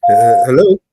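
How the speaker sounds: loud, rising hello into the phone; calling out hello. Real, not acted. Caller checking a silent phone line, loud rising hello. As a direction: call out to check anyone's still there.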